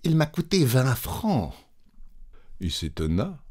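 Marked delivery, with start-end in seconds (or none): 0.0-1.5 hesitant. Acted. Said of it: "avec hésitation".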